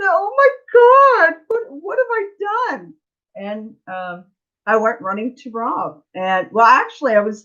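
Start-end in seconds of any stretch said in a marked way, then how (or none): 0.0-2.9 high, crying wail of regret; tearful cry. Retold or imitated. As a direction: retell the panic through tears. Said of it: "so I left him with tears".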